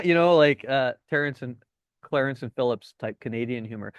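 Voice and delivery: ordinary delivery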